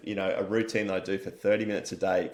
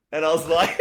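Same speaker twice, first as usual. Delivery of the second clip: high, loud laugh breaking through; real burst of laughter; 7 dB louder than usual. Real, not acted. Laughing at the jab while telling it to the host.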